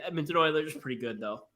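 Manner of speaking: throat catching, choking a bit; coughing through the words. Real, not acted. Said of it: "Sorry, I'm coughing. I'm choking a bit".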